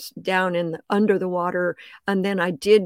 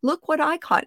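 retold call; 8 semitones higher than usual. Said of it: "Russell calls out".

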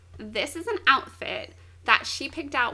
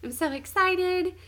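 higher-pitched, thrilled little squeal of delight; excited; 6 semitones higher than usual. Retold or imitated. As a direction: imitate a delighted girl getting new clothes. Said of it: "she gets really excited".

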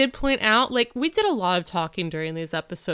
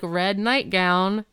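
ordinary delivery

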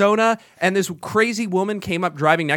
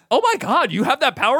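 amazed; 6 semitones higher than usual. Retold or imitated. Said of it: "I was like".

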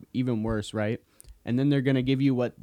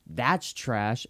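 normal talking voice

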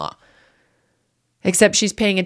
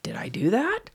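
self-doubting whisper; 7 semitones higher, 5 dB quieter than usual. Retold or imitated. Doubting herself, recalling the accusation.

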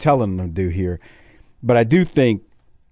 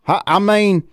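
outraged Southern viewer; 4 semitones higher than usual. Retold or imitated. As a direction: ham up an angry Southern fan ready to grab his pistol. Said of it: "they're like".

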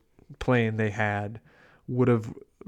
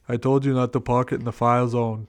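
lightly voiced Austrian accent; a hint of Arnold's Austrian accent, deadpan; Arnold impression. Retelling Arnold's reply to the mayor, light Austrian accent. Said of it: "And he's like".

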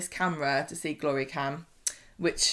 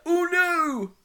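much higher mock dismay; hammed-up oh no, much higher; dismayed cry; 11 semitones higher, 3 dB louder than usual. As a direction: squeal it out in shock. Ed reacts to news.

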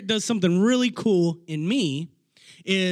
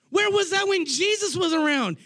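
a higher, shouted challenge; rhetorical shout; 10 semitones higher than usual. Real, not acted. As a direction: call it out to the congregation. Preacher's rhetorical outburst.

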